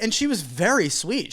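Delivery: usual speaking voice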